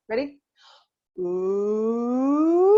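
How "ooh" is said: long ooh rising and falling; smooth siren glide; vocal siren. Shown as a demonstration. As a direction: sweep from chest voice up to head voice and back. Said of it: "Start in your chest voice".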